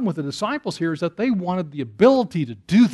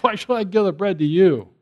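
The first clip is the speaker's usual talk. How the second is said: mock-disdainful retold question; dismissive tone; 2 semitones higher, 4 dB louder than usual. Preacher voicing Jesus refusing bread to a Gentile, dismissive. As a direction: put on a scornful refusal to make a point. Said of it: "with the tone that I said it with".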